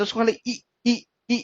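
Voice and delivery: short light ई, not held. Shown as a demonstration. Teacher showing learners the short ई sound, cut short. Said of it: "काफी हल्के से पढ़ा जाता है".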